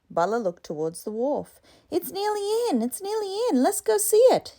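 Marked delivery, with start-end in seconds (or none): 1.9-4.6 put-on high, eager child begging; pleading. Boy begs his auntie to see the boat, high and eager. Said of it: "he pleaded".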